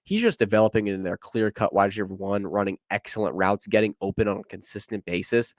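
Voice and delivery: usual speaking voice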